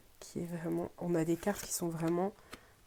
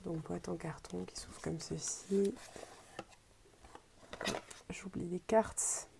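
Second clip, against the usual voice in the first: hushed unboxing whisper; 7 dB quieter than usual. Real, not acted. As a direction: whisper softly to the viewers. Quiet unboxing aside. (chuchotement doux)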